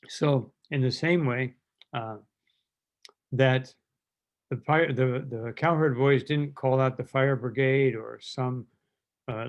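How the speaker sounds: ordinary talk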